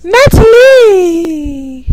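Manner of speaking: loud drawn-out mock accent on Natalie. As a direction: stretch Natalie out in a hammed-up regional accent. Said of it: "Every time you imitate somebody's area".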